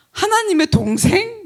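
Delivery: high, slow disbelieving question; incredulous echo. Retold or imitated. Friend hearing the odd answer, incredulous. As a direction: repeat it as if you can't believe it.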